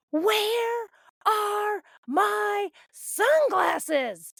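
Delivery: put-on high, slow bossy child shout; little dictator yell. Daughter storming out demanding sunglasses, high bossy shout. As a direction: bellow it like a tiny tyrant. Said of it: "storms out of her room like a little dictator".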